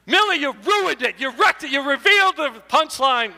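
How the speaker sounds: high playful complaint; high loud mock accusation; mock scolding. Real, not acted. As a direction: tease her for ruining the joke. Scolding Millie for spoiler.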